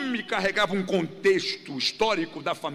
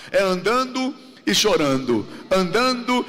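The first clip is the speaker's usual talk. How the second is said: "andando" said loud and stretched; preacher stresses "andando" to the congregation; 5 dB louder than usual. Shown as a demonstration. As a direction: drive "andando" home, then repeat "andando" alone, loud. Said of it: "a Bíblia é com ênfase".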